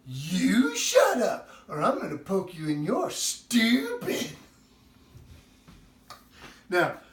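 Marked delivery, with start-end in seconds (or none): 0.1-4.3 put-on child's sulky comeback. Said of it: "and then you hear".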